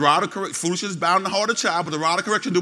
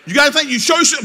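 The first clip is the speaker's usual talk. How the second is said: a hint of a stern mama, higher and louder; challenging mama; 7 semitones higher, 8 dB louder, faster than usual. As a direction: dare the child to talk back. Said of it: "Your mama said".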